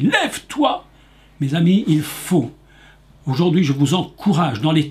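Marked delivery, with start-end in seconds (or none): 0.0-0.9 loud voice. Acted. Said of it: "il dit d'une voix forte".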